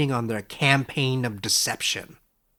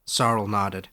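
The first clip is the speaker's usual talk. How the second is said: usual speaking voice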